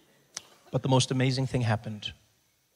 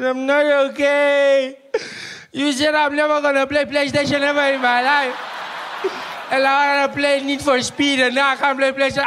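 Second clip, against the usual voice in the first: put-on childish crying; 12 semitones higher, 7 dB louder than usual. Little brother crying over PlayStation, high wailing sobs. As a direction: blubber like a heartbroken kid. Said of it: "He says".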